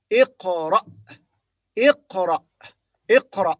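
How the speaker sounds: iqra with a hard throaty q; Arabic iqra. Shown as a demonstration. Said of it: "So we say iqra".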